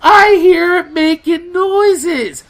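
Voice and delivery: loud, high, drawn-out mock wail; hammed-up tearful protest; imitated crying girlfriend. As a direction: whine it slowly as if bawling. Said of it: "she's crying".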